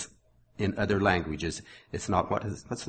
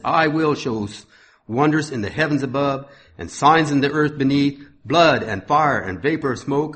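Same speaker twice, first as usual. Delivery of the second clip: raised, ringing sermon reading voice; scripture reading; 3 semitones higher, 5 dB louder than usual. Acted. Preacher reading Acts to his congregation, proclaiming.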